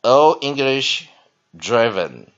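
slow, loud, deliberate reading voice; dictionary read-aloud. Shown as a demonstration. Teacher reading definitions and example sentences to class, slow and emphatic.

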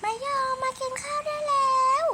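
high, loud call to dinner; high sing-song call to come eat; mother calling out. Pretend. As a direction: call the kid in from across the house. Mother calls child again.

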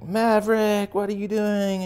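high, loud radio chatter from a pilot. Pretend. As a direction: yell it over the cockpit radio. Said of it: "And it's like".

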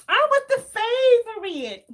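high, slow, smug mock quote; teasing a friend's bragging; mocking Marlo. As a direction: lift it up high and drag it out, teasing. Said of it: "Listen to Marlo".

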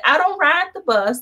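indignant. Retold or imitated. Telling the tow company she doesn't ride buses, indignant and loud. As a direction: bristle at the suggestion, high and loud. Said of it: "I was like".

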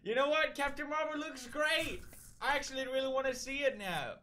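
high, eager exclamation; high-pitched burst of hype; excited enthusiasm. Acted. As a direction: gush as if suddenly won over. Sudden praise for a film.

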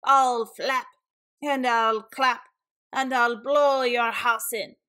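loud, chanting eagle threat; big bad bird voice; squawking eagle. A narrator's reading. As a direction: squawk each threat out forcefully. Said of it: "he squawked".

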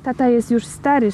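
plain reading voice

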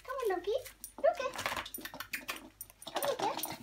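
very high coaxing call to the cat; high pet call. Acted. Owner calling her wild kitty Loki into the shed, very high.

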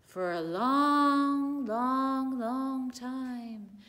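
slow, loud stretched words; drawn-out. Acted. Storyteller stretching the long journey for children, slow and loud.

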